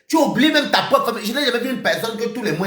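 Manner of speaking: usual speaking voice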